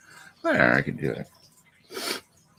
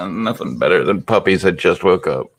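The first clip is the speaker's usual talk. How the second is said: normal talking voice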